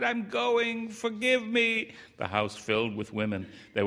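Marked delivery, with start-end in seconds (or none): 0.0-1.9 high, slow, pained farewell; crying out in pain. A narrator's reading. The wife in labour begs her husband's forgiveness, high and pained. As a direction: gasp it out like a dying plea. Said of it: "she cried".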